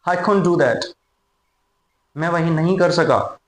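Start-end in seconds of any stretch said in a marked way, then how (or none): 0.1-0.8 "couldn't" run together as koont; spoken contraction. Shown as a demonstration. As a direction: drop the d of "couldn't", casual speech. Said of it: "speaking में बोला जाता है भाई कुंट".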